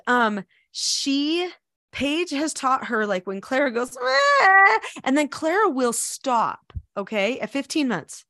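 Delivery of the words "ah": high, loud mock whine; imitated baby whine. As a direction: imitate a whining baby on one held note. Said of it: "She will stop the whining".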